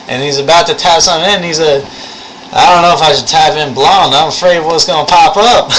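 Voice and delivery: loud, high Southern storytelling voice; thick Southern accent. Retold or imitated. Lab instructor worried about a search, thick Southern accent. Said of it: "only with a thick Southern accent".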